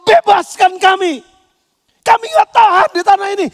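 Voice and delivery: enslaved Israelites crying out in Egypt. Retold or imitated. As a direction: plead to be set free. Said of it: "Mereka teriak".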